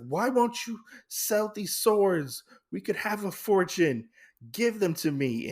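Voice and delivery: grab for the fortune, insisting; demanding brother. Retold or imitated. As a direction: brother fighting sister for the swords. Brother tussles sister for swords.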